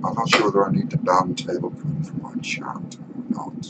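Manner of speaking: whispered aside; breathy stage whisper, no voice. Real, not acted. Aside about banning a chatter.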